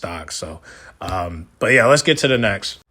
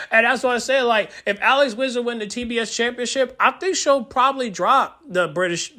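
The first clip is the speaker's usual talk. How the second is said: high-pitched excited talk; still hyped; 10 semitones higher than usual. Real, not acted. Fan still excited about his team. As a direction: keep the pitch up, still buzzing.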